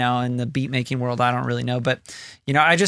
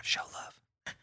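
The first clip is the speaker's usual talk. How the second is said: hushed whisper; 16 dB quieter than usual. Real, not acted. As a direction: breathe it softly. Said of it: "Why are we whispering?".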